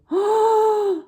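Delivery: loud high gasp of surprise; big gasp. Acted. Teacher gasping at the robbers on the hill, mock shock.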